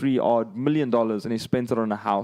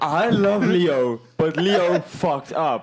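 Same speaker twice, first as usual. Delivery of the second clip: laughing; 4 semitones higher, 4 dB louder, much slower than usual. Real, not acted. Playful defence of Leo.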